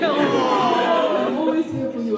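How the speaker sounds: laughing. Real, not acted. Mum laughing, reassuring daughter.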